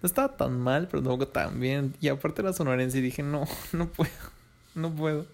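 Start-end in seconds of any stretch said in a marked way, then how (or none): 3.2-5.2 laughing through a no, pitched up; amused, laughing no; laughing refusal. Retold or imitated. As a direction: giggle out the refusal. Laughing refusal of sonorense date.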